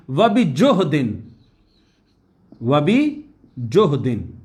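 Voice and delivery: बिजुहदिन drawn out slowly, syllable by syllable; slow drilled Arabic word. Shown as a demonstration. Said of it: "और बड़ी मेहनत के साथ".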